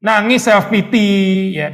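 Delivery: normal talking voice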